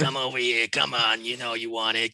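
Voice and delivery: low, rasping chain-smoker granny; smoker old lady voice. Pretend. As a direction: lure them in like an old smoker. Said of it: "an old lady who's been smoking all her life".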